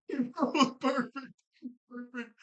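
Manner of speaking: squeaky high replay of the line, slowed down; high, slow, delighted repeat. Real, not acted. Vlogger replaying a perfect moment, squealing it high and slow. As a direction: savor each word up high through laughter.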